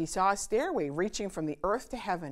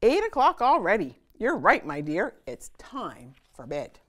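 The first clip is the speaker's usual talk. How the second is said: papa voice; 4 semitones higher, 6 dB louder than usual. A narrator's reading. Papa checks clock.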